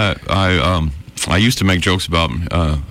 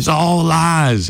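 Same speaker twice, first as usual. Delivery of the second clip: mock outrage; 10 semitones higher, much slower than usual. Real, not acted. Echoing friend about fake photos.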